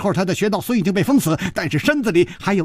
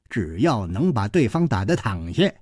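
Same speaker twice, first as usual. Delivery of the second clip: put-on low-pitched, offhand old man's lecture; smug old fighter; 10 semitones lower, 4 dB quieter than usual. A narrator's reading. Old man explains fighting to his victim, smug and low. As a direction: lay down the rule in a low, smug voice.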